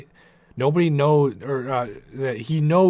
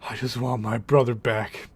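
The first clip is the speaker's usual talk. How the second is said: lightly voiced grieving friend; pleading Cisco. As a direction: ask for the brother back, holding it in. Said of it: "Cisco's argument is".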